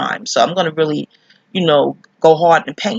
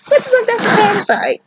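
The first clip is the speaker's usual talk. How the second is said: outraged exclaim. Real, not acted. Ranting at an uninvited intruder.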